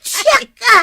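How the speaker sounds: high, loud snap back; angry retort. Acted. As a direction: throw it back at her, furious. Angry quarrel between neighbours. (nakapungtot a sungbat)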